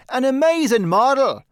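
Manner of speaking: loud, high excited shout. A narrator's reading. Painter Trevor echoing his friend, loud shout. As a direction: bellow it back at the top of your voice. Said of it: "shouted Trevor, at the top of his voice".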